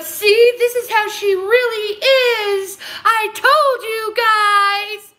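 mocking fake-sad voice, high; whiny put-on mock of a critic; high fake-crying mock voice. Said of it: "With a little fake, little fake voice on".

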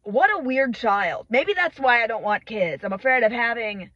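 ordinary delivery

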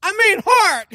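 scolding the script. Acted. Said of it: "that's what it says".

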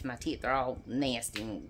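usual speaking voice

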